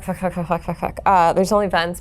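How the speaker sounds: ordinary talk, nothing marked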